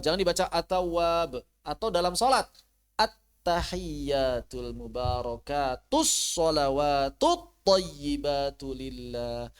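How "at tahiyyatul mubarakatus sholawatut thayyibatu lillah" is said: slow careful recitation. Shown as a demonstration. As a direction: recite slowly, each syllable drawn out. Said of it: "Hati-hati lagi sholat". (bacaan tahiyat pelan dan hati-hati)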